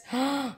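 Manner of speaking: sharp delighted gasp; quick gasp, relishing the memory; gasp di piacere. Real, not acted. Recalling a delicious dish.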